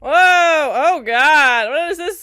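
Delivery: loud, high startled exclamation; startled shock. Real, not acted. Viewer startled by a snake thing in the sewer, startled shock. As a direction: cry out as something jumps into view.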